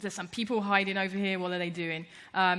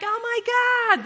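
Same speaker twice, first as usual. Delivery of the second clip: very high, louder, slow mock squeal; excited squeal; 7 dB louder than usual. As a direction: gush like a thrilled fan. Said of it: "Sam would be like".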